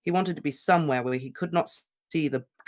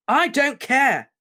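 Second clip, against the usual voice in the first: slow, much higher and louder yell; drawn-out furious yell; 12 semitones higher, 8 dB louder, much slower than usual. Acted. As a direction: yell it at the portraits. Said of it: "Harry yelled at them".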